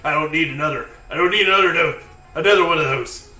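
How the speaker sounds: loud, high gruff stammer with laughter. Acted. Gruff creature refusing another dose, loud and stammering. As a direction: insist loudly, gruffly, tripping over the words. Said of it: "the gruff voice again says".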